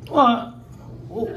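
loud, startled exclamation; real jolt of surprise; startled whoa. Real, not acted. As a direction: caught off guard mid-answer. Startled by something suddenly switched on.

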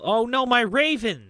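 loud, high-pitched wail of dismay; dismayed cry. Real, not acted. Gamer losing his raven, loud dismayed cry. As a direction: cry out over a sudden loss.

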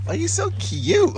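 high, slow cooing. Real, not acted. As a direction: stretch it out cooing at a puppy. Baby talk to dogs.

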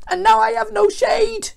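very high, loud wail; acted outraged moan; groaning lament. A narrator's reading. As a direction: whine it out in self-pity. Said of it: "he groaned".

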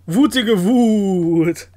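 loud, very slow mock rage; mock angry. Streamer invents an angry phrase for his Mii, hammed-up loud and slow. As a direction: stretch each word out in hammed-up fury.

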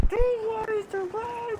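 high, slow drawn-out complaint; exasperated whine. Real, not acted. Gamer complaining to nobody about lag, exasperated whine. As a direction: stretch it out in disbelief.

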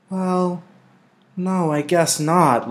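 put-on high, slow, grudging child's answer; reluctant girl. A narrator's reading. Lily reluctantly concedes to her mother, grudging. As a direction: give in unwillingly. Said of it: "Lily acknowledged reluctantly".

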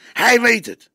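put-on young detective insisting to his boss, insistent. A narrator's reading. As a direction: press the point hard.

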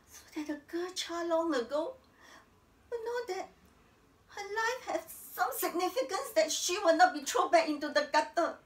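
high, building to loud defiance; impassioned climax. Acted. Woman vowing the girl won't be thrown back, passionately. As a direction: insist on it with rising passion.

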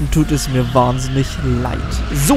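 usual speaking voice

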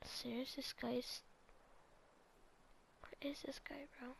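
soft real whisper, listening for footsteps; barely voiced, keep silent. Real, not acted. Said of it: "I'm gonna be quiet for this moment".

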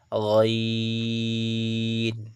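গইন with the "ee" held very long; drawn-out. Shown as a demonstration. Teacher models for students, গইন drawn out long. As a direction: stretch গইন steadily before the n. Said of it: "এগুলো একটু করে টানবেন".

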